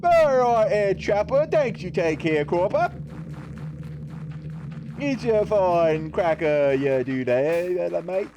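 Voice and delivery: mock British accent. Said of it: "talking like that".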